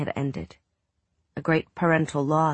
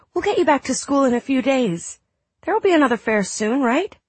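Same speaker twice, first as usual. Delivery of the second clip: reassuring mother; 10 semitones higher, 4 dB louder than usual. Acted. Mother reassures sick son.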